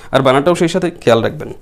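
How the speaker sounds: usual speaking voice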